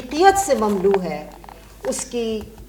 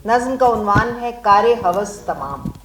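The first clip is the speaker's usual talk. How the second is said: usual speaking voice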